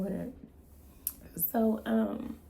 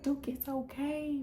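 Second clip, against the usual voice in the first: soothing; 6 semitones higher, 4 dB quieter, slower than usual. Retold or imitated. Mom soothing scared son.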